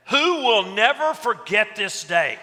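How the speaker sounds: slow, high, loud demand; mock-outraged shout. Speaker stopping his talk over a ringing phone, mock-outraged shout. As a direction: call out the culprit, hammed-up outrage.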